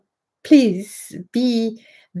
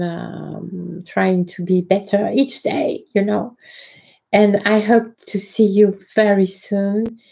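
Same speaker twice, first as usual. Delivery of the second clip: ordinary delivery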